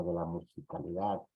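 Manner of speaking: usual speaking voice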